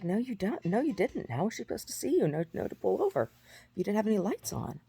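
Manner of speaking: high-pitched retold bickering; acted-out protest to an officer; argumentative contradiction. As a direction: argue back indignantly, pitch up.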